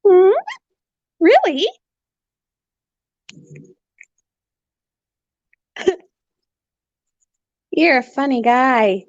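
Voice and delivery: high playful pet voice; sing-song pet chatter. Real, not acted. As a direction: tease the bird gently up high. Talking to bird.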